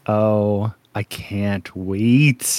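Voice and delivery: slow, low, drawn-out longing; savoring anticipation. Real, not acted. Host told of beautiful men to come, savoring anticipation. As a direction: stretch out CAN'T WAIT with relish. Gushing over upcoming beautiful characters.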